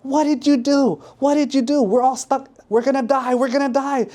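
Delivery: high-pitched frantic accusations; panicked yelling. Acted. Stranded swimmers yelling at prankster, in panic. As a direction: yell in alarm, words tumbling out high. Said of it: "yelling at him like".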